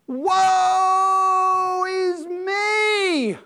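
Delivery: long drawn-out wail, high and loud; drawn-out anguished howl of woe; anguished cry. Acted. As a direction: cry out in despair before God. Isaiah crying out before the king.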